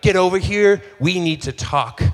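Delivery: mock angry. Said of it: "like, angry".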